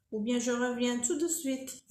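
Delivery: ordinary talk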